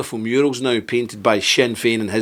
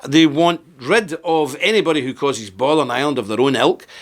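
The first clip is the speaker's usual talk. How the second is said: ordinary delivery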